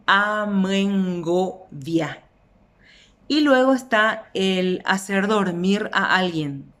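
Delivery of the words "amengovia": "amengovia" lento: a, men, go, via; silabeado. Shown as a demonstration. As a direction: alarga cada sílaba de "amengovia". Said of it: "el ngue se utiliza allí". ("amengovia" pausado, marcando el ngo)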